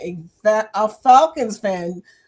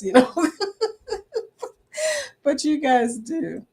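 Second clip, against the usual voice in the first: ordinary talk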